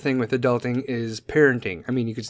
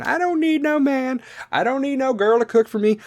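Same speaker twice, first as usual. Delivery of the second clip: sassy voice; 10 semitones higher than usual. Retold or imitated. Sassy independent adult impression.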